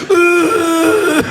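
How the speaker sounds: high-pitched mindless whoop; mock drawn-out fan holler; stoned crowd cheer. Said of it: "They would have done the same thing".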